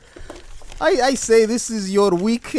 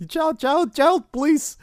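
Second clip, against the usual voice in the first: higher, hammed-up sassy woman; a lighter take on the exasperated lady; mock sassy woman; 6 semitones higher, slower than usual. Comedian imitating a loser whining like a woman, lighter.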